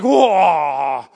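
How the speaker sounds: loud whoa sliding way up, laughing; hammed-up awe at a clever kid; amazed praise. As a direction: gush like you're blown away. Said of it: "It's not like".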